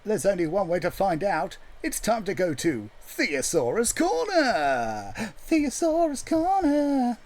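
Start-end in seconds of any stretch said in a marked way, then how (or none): 1.8-7.2 drawn-out jingle, rising high on the repeat; mock show announcer. Songwriter looking up words for siblings, mock jingle announcement. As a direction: announce it like a silly segment jingle.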